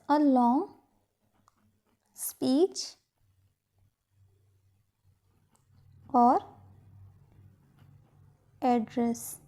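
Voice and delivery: soft, broken up with long pauses; quiet word-by-word dictation, leaving time to write. Shown as a demonstration. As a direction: dictate it piece by piece. Dictates the same phrase in pieces.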